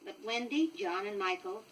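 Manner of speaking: usual speaking voice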